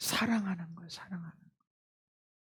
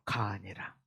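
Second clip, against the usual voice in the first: ordinary talk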